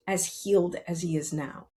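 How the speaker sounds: usual speaking voice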